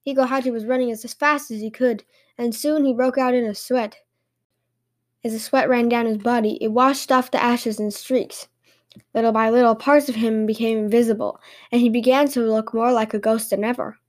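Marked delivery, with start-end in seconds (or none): none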